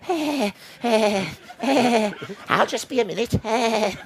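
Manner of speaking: high, strained puppet hare voice; Hartley Hare voice. Retold or imitated. Hartley in the bathroom answering Pig, high strained puppet voice. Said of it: "he'd be going".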